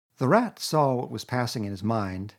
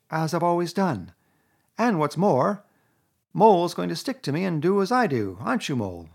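higher, louder flat refusal; stubborn Rat; 6 semitones higher, 5 dB louder than usual. A narrator's reading. Rat refusing Toad flatly, stubborn. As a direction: put his foot down.

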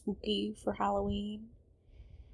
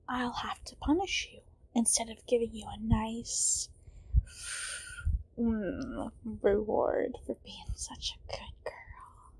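teasing whisper; 4 dB quieter than usual. Acted. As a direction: tease her in a hushed voice. Teasing bedtime talk to partner.

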